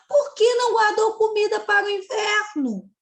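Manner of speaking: high, loud reproachful ant question; scolding question. Acted. Teacher voicing the ant's reproachful question to the cicada, high and loud. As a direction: ask it as a sharp rebuke. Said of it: "Outra pergunta".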